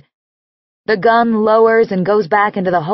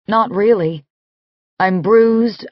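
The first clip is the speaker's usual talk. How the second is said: plain reading voice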